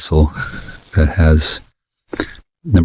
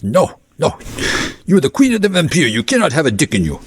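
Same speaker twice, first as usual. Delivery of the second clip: much higher mock voice for the boyfriend; imitating boyfriend; 10 semitones higher than usual. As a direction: play the boyfriend scolding in a silly high voice. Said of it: "but he says".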